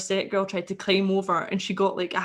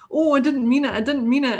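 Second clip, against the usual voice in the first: higher and louder, whiny backpedalling; a lightly voiced girl squealing an excuse; mock pleading girl; 5 semitones higher, 6 dB louder than usual. Girl backing down when threatened.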